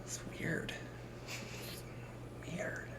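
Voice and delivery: whispered, slow mother's worry; a mock-whispered suspicious mom; whispering mom smells pizza; hushed mom.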